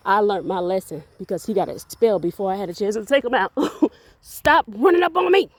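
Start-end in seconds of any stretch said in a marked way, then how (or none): 4.2-5.5 startled laughing yelp at the dog. Real, not acted. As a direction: jump and laugh, then snap it out.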